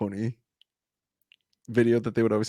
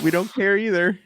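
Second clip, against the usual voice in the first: lightly acted, higher-pitched comeback; mock neighbor retort; 9 semitones higher, 4 dB louder than usual. Mom walking her dogs answering the yeller, mock retort.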